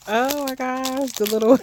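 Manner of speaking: high, loud squeal breaking into a laugh; delighted laugh. Real, not acted. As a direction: laugh with joy at the tiny seals. Delight at the little seals.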